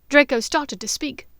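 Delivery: usual speaking voice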